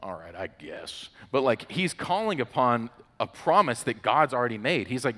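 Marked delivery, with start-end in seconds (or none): 0.0-1.1 soft, whispery grudging give-in; reluctant God. Acted. God finally gives in to Moses, grudging and whispery. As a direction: sigh it out as a quiet, resigned concession. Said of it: "God's finally like".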